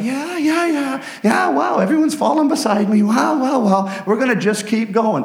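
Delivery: high, amazed cheering; excited worshippers. Acted. Worshippers watching enemies fall beside them, high excited cheer. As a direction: cheer like praisers seeing foes fall.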